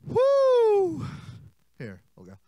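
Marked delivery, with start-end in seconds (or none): 0.1-1.0 long high exhausted woo; tired whoop. Acted. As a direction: blow out a loud weary woo. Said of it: "he's just like".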